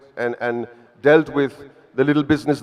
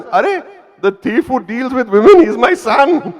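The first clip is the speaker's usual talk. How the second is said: high, louder, laughing mock pride; proud Parsi mom; 10 semitones higher, 6 dB louder than usual. Mother boasts to the audience about her son, high and proud. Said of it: "With great pride".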